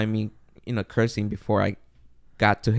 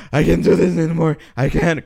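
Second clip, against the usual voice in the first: higher and breathless, worn out from running; out of breath; 4 semitones higher, 3 dB louder than usual. Retold or imitated. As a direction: gasp the words like you can't run another step. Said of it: "I'm, like, out of breath".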